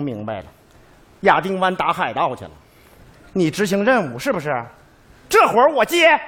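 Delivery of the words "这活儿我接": higher, louder, resolute declaration; firm shout. Acted. Caregiver tells the soldier's wife he takes the job, loud and firm. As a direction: announce it proudly for all to hear.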